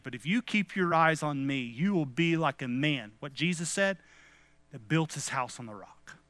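ordinary talk, nothing marked

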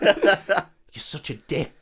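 can't keep a straight face, giggling through it; laughing. Real, not acted. Cracking up reading the line.

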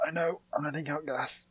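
whispered confession; whispering. Real, not acted. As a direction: hush it, all breath.

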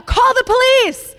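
urgent yell at the crash site; retold yell. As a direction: shout it urgently across the scene. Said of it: "you began hearing someone yell".

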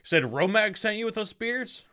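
mock-polite torturer. Torturer questioning the delivery man, mock-polite and rising. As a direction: fake courtesy over a muffled scream. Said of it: "And he's like".

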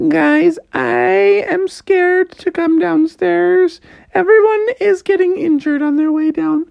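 cartoon small-child voice; scared little sister. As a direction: squeak it out carefully in falsetto.

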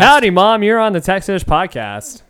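hearty phone hello; called-out greeting. Real, not acted. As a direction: announce it loudly into the speakerphone. Joins in greeting mom on phone.

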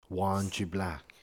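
ordinary talk

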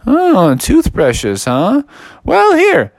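high, swooping affected voice; storybook museum buyer, intrigued; surprised museum expert. Acted. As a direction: react in a high, drawn-out official's voice. Museum buyers react to request.

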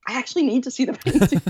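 eager. Retold or imitated. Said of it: "And I'm like".

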